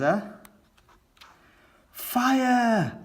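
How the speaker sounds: high, loud cry of fire; shouted. Acted. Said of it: "shouts Daddy Pig".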